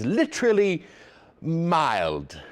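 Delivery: put-on playful English accent, high start then settling; put-on British weatherman. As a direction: show off a mock English accent. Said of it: "with an English accent".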